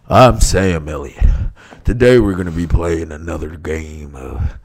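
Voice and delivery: Sam Elliott. Acted. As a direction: draw it out deep and laconic. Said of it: "I can't deal with Sam Elliott".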